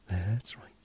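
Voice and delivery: soft whispered affirmation. Acted. Hypnotist reassuring the listener, whispered. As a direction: breathe it right into their ear.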